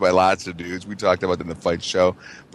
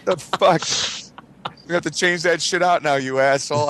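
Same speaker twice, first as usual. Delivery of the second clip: high-pitched comic complaint; mock irate line boss; 8 semitones higher than usual. Boss to worker who fried his foot, mock irate. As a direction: gripe as the exasperated boss, voice well up.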